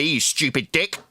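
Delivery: ordinary talk, nothing marked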